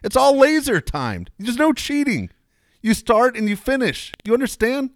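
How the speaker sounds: high-pitched heated rant; angry rant. Real, not acted. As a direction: rant at him, loud and high.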